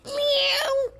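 squeaky falsetto meow; cat meow. Pretend. Playing a cat for the interviewer, falsetto meow. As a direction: mew like a small cat, high up. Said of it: "says the cat".

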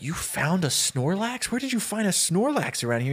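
high, disbelieving questions; incredulous. Retold or imitated. Ben facing his rival's giant Snorlax, incredulous. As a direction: question it in disbelief, pitch climbing.

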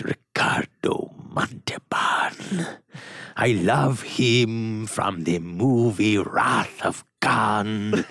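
very slow, drawn-out accented impression; Ricardo Montalban impression. Host showing Richard a favourite impression, Ricardo Montalban voice. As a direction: savour each word with theatrical slowness. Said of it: "one of my favorite impressions".

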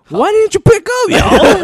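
very high, loud shouted dad impression; mock mad dad. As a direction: yell indignantly as the dad, half laughing. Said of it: "when he gets mad".

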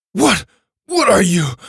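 high, loud, startled questions; shrieking alarm. A narrator's reading. Driver sees Kai appear beside him, alarmed shriek. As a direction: cry out in shock. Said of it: "The driver let out a little shriek".

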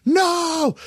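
high-pitched cry of dismay; dismayed shout. Retold or imitated. Driver finding the car long gone, high dismayed cry. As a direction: yell it out in retold disbelief.

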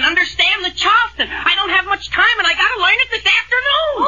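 excited. Acted. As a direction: work yourself up to a frantic pitch. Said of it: "Don't get so excited".

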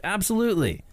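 "Absolutely" sung out high like a signature line; mock catchphrase. Shown as a demonstration. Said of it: "we say it like a hundred times per episode".

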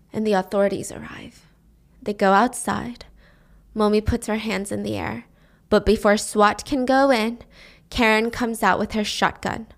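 ordinary delivery, nothing marked

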